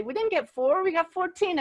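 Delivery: climbing, overjoyed reveal of fourteen; thrilled announcement. Retold or imitated. Retold thrilled announcement of total.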